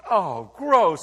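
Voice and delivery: loud, disgusted "Oh, gross"; disgusted cry. Pretend. Listener finding a week-old cup of milk, loud disgust. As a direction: recoil at a filthy cup.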